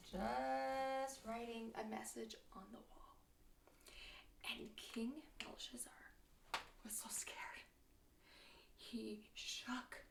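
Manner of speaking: soft, hushed storytelling; quiet, ominous telling for children; suspenseful hush. A narrator's reading. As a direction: drop your voice for the spooky moment.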